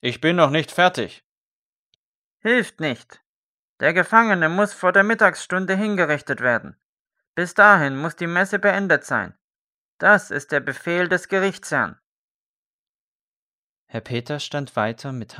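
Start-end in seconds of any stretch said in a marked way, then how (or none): 0.0-1.2 higher, loud call through the door. A narrator's reading. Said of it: "rief der Pfarrherr". 2.4-12.0 shout. A narrator's reading. Official shouting through door.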